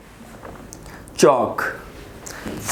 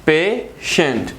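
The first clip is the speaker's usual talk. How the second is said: Patient slowly split as पे, shunt; teacher sounding out patient in two slow syllables; much slower than usual. Shown as a demonstration. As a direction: break patient into two separate beats, pausing after पे. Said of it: "जिसमें आ रहा है पे and shunt".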